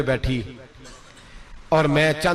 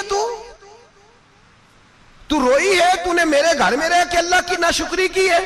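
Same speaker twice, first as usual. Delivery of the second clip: furious rebuke; 12 semitones higher than usual. Retold or imitated. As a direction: berate the wife in righteous anger. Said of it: "हजरत उमर जलाल में आ गए".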